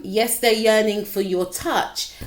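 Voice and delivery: ordinary delivery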